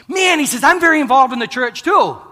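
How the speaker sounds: high, loud eager reply; excited. Retold or imitated. Bow hunter eagerly telling the preacher about church.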